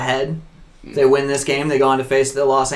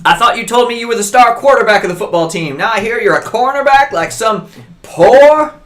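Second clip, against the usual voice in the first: higher, louder scolding father; 8 semitones higher, 5 dB louder than usual. Acted. Dad scolding his son over the lie, angry. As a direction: dress him down like a disappointed snob.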